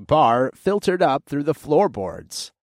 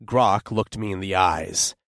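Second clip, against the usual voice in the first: ordinary delivery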